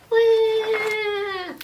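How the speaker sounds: put-on high-pitched baby cry; baby wail. Baby cries again.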